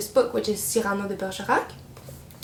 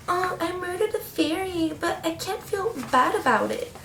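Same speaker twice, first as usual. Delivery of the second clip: higher whiny mock of the heroine; 7 semitones higher than usual. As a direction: imitate her whiny, careless tone. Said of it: "And she's like".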